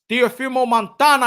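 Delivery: usual speaking voice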